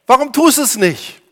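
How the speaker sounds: laut und hoch gefragt; eindringliche Frage. Acted. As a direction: ruf die Frage drängend heraus. Prediger ruft Frage heraus. (laute, drängende Frage)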